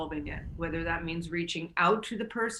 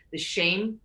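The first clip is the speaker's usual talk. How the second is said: ordinary delivery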